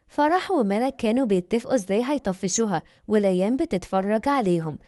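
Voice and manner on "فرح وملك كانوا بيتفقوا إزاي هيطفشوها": ordinary delivery, nothing marked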